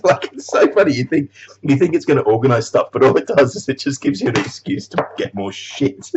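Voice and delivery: usual speaking voice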